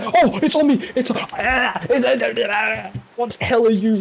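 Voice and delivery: high, loud laughing shrieks; laughing panic. Real, not acted. Gamer grabbed by a creature, high laughing panic.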